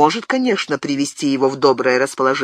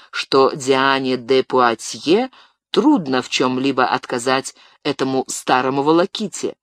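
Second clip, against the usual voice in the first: usual speaking voice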